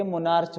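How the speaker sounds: मोनार्च ending in "ch", as in march; mispronounced ch. Shown as a demonstration. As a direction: say मोनार्च with a final "ch". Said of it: "मोनार्च नहीं".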